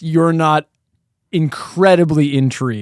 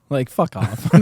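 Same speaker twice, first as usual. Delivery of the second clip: usual speaking voice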